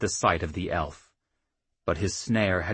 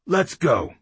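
gruff command; 9 semitones higher, 6 dB louder than usual. A narrator's reading. Chief decides to act.

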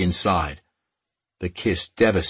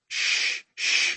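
breathy hush to calm her; soothing shush. A narrator's reading. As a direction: shush her tears gently. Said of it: "he said".

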